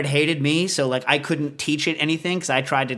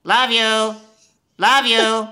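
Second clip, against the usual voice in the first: high squawky parrot calling love you then honey; 8 semitones higher, 5 dB louder, much slower than usual. Retold or imitated. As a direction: mimic a lonely parrot crying out for its owners, climbing higher. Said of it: "he would start saying".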